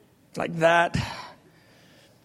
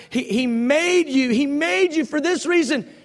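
louder, higher, building emphasis; rising preaching; 8 semitones higher, 4 dB louder than usual. Acted. Preaching climax.

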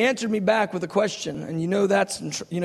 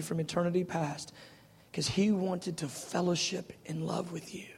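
much softer, intimate delivery; soft preaching; 8 dB quieter than usual. Acted. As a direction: speak it close and tender.

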